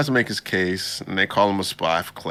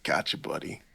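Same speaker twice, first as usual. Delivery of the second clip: quiet knowing murmur; 5 semitones lower, 6 dB quieter than usual. Retold or imitated. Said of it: "And I was like".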